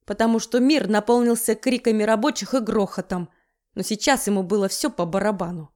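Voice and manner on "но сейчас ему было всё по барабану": ordinary delivery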